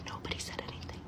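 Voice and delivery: near-whispered, barely audible aside; hushed repeat. Real, not acted. Teller on the family's silence, hushed repeat. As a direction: drop it to a secretive whisper.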